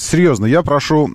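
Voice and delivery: usual speaking voice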